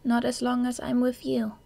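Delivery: put-on tender girl voice. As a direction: say it close and gentle. Kyoka's tender reply.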